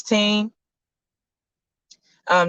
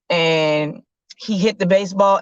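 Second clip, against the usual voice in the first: ordinary talk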